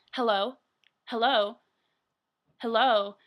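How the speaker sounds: loud mock hello, spaced out; imitated impatient calling. Waiting woman at the speaker box, mock impatient calling.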